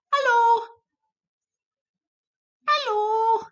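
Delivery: high, sing-song hello to a pet; pet greeting. Real, not acted. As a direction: coo way up high at the dog.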